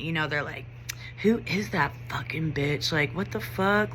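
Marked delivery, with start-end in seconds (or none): 1.2-3.9 mock jeer said in an undertone, voice put on; taunting. Students following behind a teacher, imitated taunting. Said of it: "taunting me".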